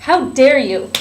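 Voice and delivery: loud indignant outburst; outraged shout. Acted. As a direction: shout it, scandalized. Retake after ready go.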